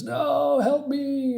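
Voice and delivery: high, desperate cry for help. Acted. Man burning alive begs for help, high and panicked.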